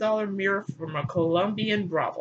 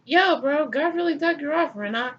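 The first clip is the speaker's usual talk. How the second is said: a touch higher dude-bro voice; lightly hammed bro; lightly voiced Cain; 6 semitones higher than usual. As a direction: greet casually with a hint of menace. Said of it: "He's like".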